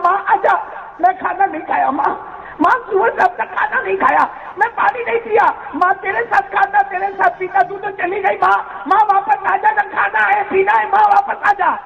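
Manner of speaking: high, keening cry to a dead mother; wailing lament. Retold or imitated. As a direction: wail it out like a son at the funeral. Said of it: "اور بیچارے کیا بولتے معلوم". (بیٹے کا بلند آواز میں روتے ہوئے ماں کو پکارنا)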